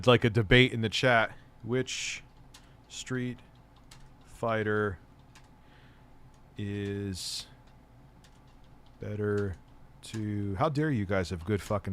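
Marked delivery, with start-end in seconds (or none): 1.6-10.6 quiet, word by word, long pauses; slow dictation. Real, not acted. Streamer typing a poll for chat, slow dictation. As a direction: softly voice words while typing.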